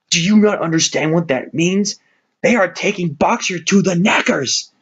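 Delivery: horrified outcry. Acted. Benjamin tells the animals Boxer goes to the knackers, horrified. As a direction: make them grasp the horror.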